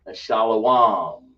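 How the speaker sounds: and shalom drawn out slowly as shalowam; mock the fake camp's shalowam; mocking wrong pronunciation.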